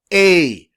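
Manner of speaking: a said as a long vowel, like in cake. Shown as a demonstration. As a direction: give a the long sound. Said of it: "The long vowel".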